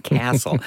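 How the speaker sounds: "Castle" with a short American a; American pronunciation of Castle. Shown as a demonstration. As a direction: stress "Castle" with the a of cat. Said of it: "For American listeners out there".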